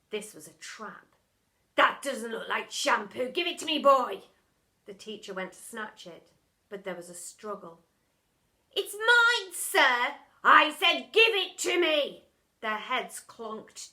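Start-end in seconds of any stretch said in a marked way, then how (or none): 1.7-4.3 lightly voiced pompous teacher, louder; a hint of a sneering schoolmaster; stern teacher. A narrator's reading. Teacher gloating at the boy, lightly pompous. 8.7-10.2 put-on high, loud boy; cling on defiantly; boy struggling. A narrator's reading. Boy struggling with teacher, defiant. 10.4-12.3 loud, slow demand; angry teacher. A narrator's reading. Teacher snatching the glue, angry.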